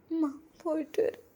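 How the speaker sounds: choked, tearful farewell to mother; tearful goodbye. Acted. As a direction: say goodbye while holding back tears. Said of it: "മോളെന്തെങ്ങനെ കരയണത്?".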